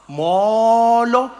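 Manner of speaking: "Molo" with a long, wide-open first vowel; drawn-out Xhosa greeting "Molo"; open-mouthed Xhosa. Shown as a demonstration. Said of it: "we open our mouths".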